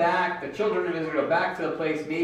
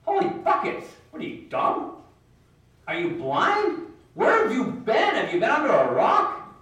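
incredulous; 6 semitones higher than usual. Retold or imitated. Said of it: "they'd be like".

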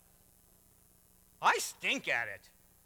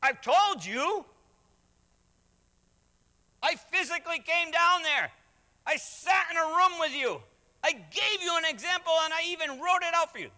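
much higher, louder exasperated voice; imagined Jesus, exasperated; 10 semitones higher, 6 dB louder than usual. Retold or imitated. Jesus answering a believer who wants an example, exasperated. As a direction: play Jesus fed up with being asked again. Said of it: "I can just imagine he's like".

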